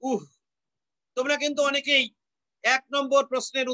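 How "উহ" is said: short উহ closing on h; breathed h ending. Shown as a demonstration. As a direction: end উহ in a puff of air. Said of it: "বিসর্গ দিলে উচ্চারণ হবে".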